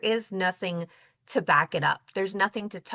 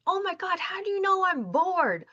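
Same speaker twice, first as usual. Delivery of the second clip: much higher, astonished exclamation; an imitated client who can't believe it; amazed client; 12 semitones higher than usual. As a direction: gasp out his amazement.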